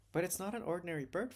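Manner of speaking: pitched high in protest. Acted. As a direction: plead the case, voice lifted. Acted dialogue, daughter protesting to father.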